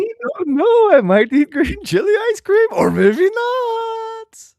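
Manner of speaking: high, slow, drawn-out villain voice; vaudeville villain. Pretend. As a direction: ham it up like a stage villain. Silly high voice returns.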